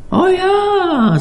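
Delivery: hoch; eerie cook voice. A narrator's reading. Koch im Roman spricht.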